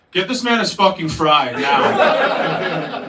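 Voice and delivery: slow, forceful mock-heroic order; loud demand. Self-styled Batman ordering counter staff around, loud demand. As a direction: make it a big, drawn-out command.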